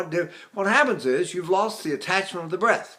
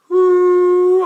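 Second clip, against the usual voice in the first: high, much louder ooh; falsetto attachment ooh; 13 semitones higher, 11 dB louder than usual. Shown as a demonstration. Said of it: "there's my attachment".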